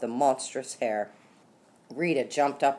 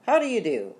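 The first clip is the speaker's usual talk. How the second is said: higher-pitched, mildly worried greeting; 7 semitones higher, faster than usual. A narrator's reading. Father greets Rita to distract her, mildly worried. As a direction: greet with uneasy politeness, voice raised in pitch. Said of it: "in a worried voice".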